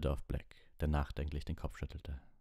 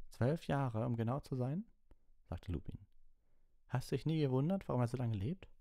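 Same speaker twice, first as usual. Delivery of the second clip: higher, gentle teacher voice; Lupin; 9 semitones higher than usual. Acted. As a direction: ask it like a patient teacher.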